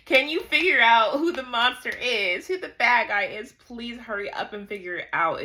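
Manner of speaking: loud, high, exasperated plea; exasperated pleading. Acted. Reader urging the heroes to figure it out, exasperated. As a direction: beg them impatiently, pitch and volume up.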